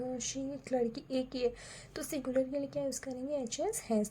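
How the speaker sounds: ordinary delivery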